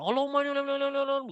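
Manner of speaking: hammed-up yelling supplication; mock shouted prayer. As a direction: parody a loud demanding prayer. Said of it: "Dengan dikeraskan".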